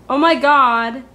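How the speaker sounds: loud, dramatic Oh my God. Shown as a demonstration. Proving the full phrase beats slang, loud dramatic cry. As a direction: belt it out like a soap opera. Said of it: "Doesn't that just sound more dramatic".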